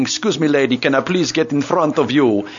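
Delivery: put-on playful Russian accent asking politely. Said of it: "All the best Russian accent come out saying".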